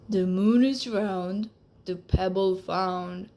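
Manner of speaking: a magic lizard setting its condition. Acted. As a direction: speak like a wise old magic lizard.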